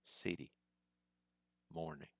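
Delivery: CITY and MORNING falling away softly at the end; first syllable stressed in CITY and MORNING, ending light; soft last syllable. Shown as a demonstration. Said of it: "the last syllable in English is quite soft".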